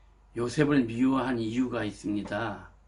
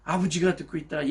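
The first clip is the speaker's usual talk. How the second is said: ordinary talk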